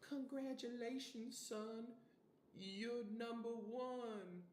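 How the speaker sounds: high, slow, teary proud-mom voice; mock proud parent. Vlogger voicing a mom praising her son, high and slow. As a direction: play the proud mother choking up. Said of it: "being like".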